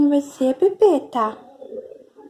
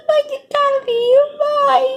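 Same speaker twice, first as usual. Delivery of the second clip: much higher, sing-song pet talk; high baby talk; 8 semitones higher than usual. Shown as a demonstration. Mother voicing the pet's love, cooed up high. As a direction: coo it up high to the cat. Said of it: "repeated in a new manner".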